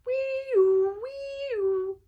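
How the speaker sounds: high wailing siren noise; kid-style siren wail; siren imitation. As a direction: sing out like a fire engine. Imitating fire truck siren.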